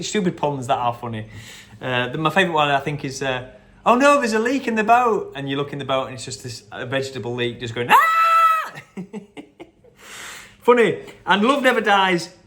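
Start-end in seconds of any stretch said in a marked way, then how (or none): 3.8-5.3 panicked movie character. Retold or imitated. Quoting a character from the film. 7.9-8.7 a sudden shrill cartoon shriek, much higher and louder; short mock shriek of alarm; high mock scream. Vegetable leak character screams.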